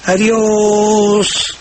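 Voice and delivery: "Adiós" elongated on its last vowel; stretched last vowel. Shown as a demonstration. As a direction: drag out the end of "Adiós". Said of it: "entonces sería".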